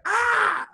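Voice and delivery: sudden high scream; startled scream. Retold or imitated. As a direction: yelp in fright. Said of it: "He's like".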